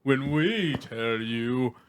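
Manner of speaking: very slow, stretched show outro; hammed-up, dragged-out closing line; drawn-out sign-off. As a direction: stretch every word out for a mock grand finish.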